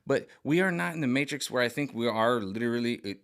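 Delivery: ordinary talk, nothing marked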